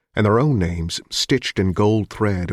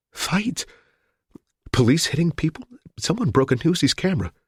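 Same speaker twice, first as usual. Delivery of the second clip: frightened; 9 semitones higher than usual. Acted. Hostage reporting chaos.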